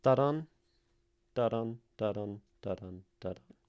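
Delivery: "da-dun" five times, weight on "dun", stepping down in pitch and volume; five iambs. Shown as a demonstration. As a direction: chant "Da-dun" in even weak-strong pairs, starting loud and fading. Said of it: "of five iambs".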